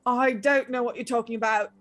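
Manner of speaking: put-on mildly flabbergasted teenage boy; a touch of baffled denial. Brother accused of endangering the kid, mildly flabbergasted. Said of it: "looks to you flabbergasted".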